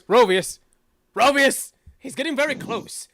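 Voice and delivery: loud call fading to an unvoiced whisper; panic, then hush it; nervous guardian. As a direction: guardian lion nervously warning his twin. Nervous lion to twin.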